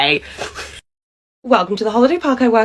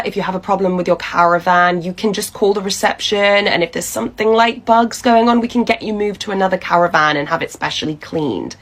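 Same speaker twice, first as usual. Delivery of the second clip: ordinary talk, nothing marked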